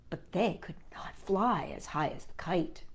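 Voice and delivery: soft whispered narration; hushed. A narrator's reading. Narrator softly ending the story, whispered.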